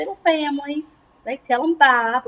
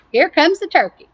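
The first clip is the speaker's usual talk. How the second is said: ordinary delivery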